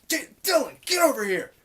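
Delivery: high, loud acted call; urgent summoning shout. Fuming clerk calling another guy over, acted urgent shout. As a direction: holler a coworker over, exasperated.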